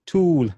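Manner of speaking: "Tool" with a long pure oo; Swahili u vowel. Shown as a demonstration. Teacher showing the Swahili oo sound with an English word. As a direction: stretch the oo in "Tool". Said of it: "the O becomes the oo".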